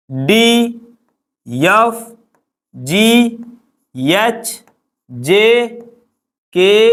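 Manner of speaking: each letter said alone with a pause after; slow letter drill. Shown as a demonstration. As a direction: call out the letters one by one for the class to repeat. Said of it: "पहले तुम बोलो फिर मैं बोल रहा हूँ".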